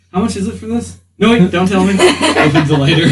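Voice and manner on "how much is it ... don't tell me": higher, louder playful shopkeeper bit; mock shopper. Player imagining a customer haggling in a shop, higher and playful. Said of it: "it goes to the shop".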